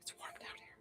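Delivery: breathy whisper to herself; whispered aside. Real, not acted. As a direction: whisper it, almost to yourself. Aside muttered off mic.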